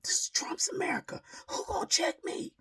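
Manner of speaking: high shrill-pitched imitation of a ranting woman; mock yelling woman. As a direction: imitate her high, loud rant. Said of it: "I'm still hearing this yelling".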